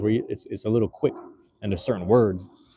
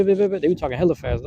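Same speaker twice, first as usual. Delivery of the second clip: ordinary talk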